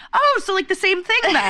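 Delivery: high-pitched mock of a clueless guy, deadpan. Friend mocking a boy's confused reply, high and silly. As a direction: play him as baffled, raising the pitch. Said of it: "And he goes".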